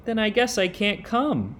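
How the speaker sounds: sad squirrel. A narrator's reading. Squirrel turning down Little Rabbit's party invitation, mildly sad. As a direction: read it as a let-down little squirrel, a touch plaintive. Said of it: "said the squirrel sadly".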